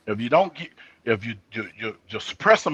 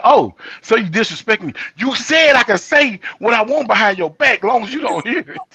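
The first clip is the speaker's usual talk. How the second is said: heated retort; 7 semitones higher, 6 dB louder than usual. Retold or imitated. Arguing back at his son.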